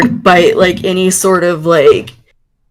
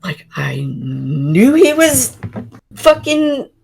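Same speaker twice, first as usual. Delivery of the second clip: stammering, drawn-out exasperation; too mad to finish the sentence, stumbling over words; slower than usual. Acted. Player voicing a character who learns of the lie, mad and stammering. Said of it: "so mad".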